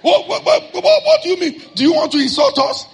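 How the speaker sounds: high, loud, indignant what-what-what; angry outburst. Retold or imitated. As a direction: explode at the shopkeeper over the price. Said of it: "I got angry".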